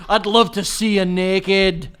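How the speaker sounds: loud, slow, drawn-out Scottish come-on; mock Scottish accent. Showing it doesn't work for anyone, loud Scottish accent. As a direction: belt it slowly in broad Scots. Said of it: "Scottish is bad".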